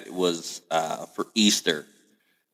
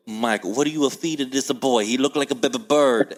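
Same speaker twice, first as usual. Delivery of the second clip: put-on higher-pitched, thick Italian accent; great-grandmother's Italian accent; 5 semitones higher than usual. Great-grandmother gets on dad about skinny grandson, thick Italian accent. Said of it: "she had that accent".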